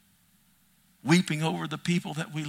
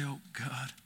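very soft, sighing Oh God; hushed prayer; barely breathed prayer; 3 semitones lower, 16 dB quieter than usual. Acted. Quiet prayer cry.

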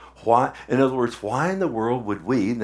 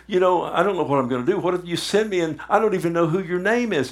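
anxious Moses; 6 semitones higher, faster than usual. Retold or imitated. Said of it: "He, he says".

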